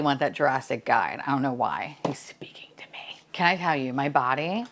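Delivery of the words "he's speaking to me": hushed whisper; secretive, barely-there whisper. Real, not acted. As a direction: whisper it under your breath. Whispering about the box speaking.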